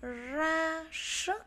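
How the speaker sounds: "Ra sức" high, rolled R and long hushed S; careful R and S. Shown as a demonstration. Teacher modelling "Ra sức" for pupils, strong R and S. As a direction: draw out the R of "Ra" and S of "sức". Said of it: "chúng ta phát âm là R".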